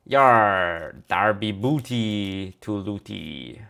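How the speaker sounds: hammed-up pirate accent, very slow. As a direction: drag it out like a cartoon pirate accent. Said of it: "a pirate accent is more like".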